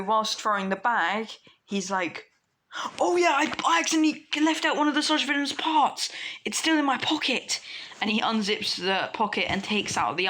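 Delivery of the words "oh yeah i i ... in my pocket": high, quick, loud burst; sudden realisation. Acted. Deku remembering the eye in his pocket, excited. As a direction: blurt it out as it dawns on you.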